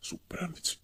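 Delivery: breathy, delighted whispering; hushed, savouring whisper; intimate whisper. Acted. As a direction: confide it secretly to a lover. Savouring a stolen kiss, intimately.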